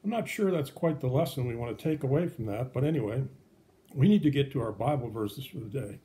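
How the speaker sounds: ordinary talk, nothing marked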